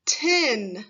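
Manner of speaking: said on its own, slow and clear; clear test word. Shown as a demonstration. Teacher saying a test word for children to listen to, clearly. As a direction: present the word for careful listening. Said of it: "You have to do your best to listen".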